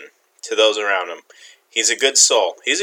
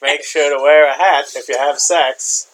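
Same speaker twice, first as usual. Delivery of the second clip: slow, put-on posh English delivery; mock British accent. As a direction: give advice like a prim Englishman. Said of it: "Oh, that's British".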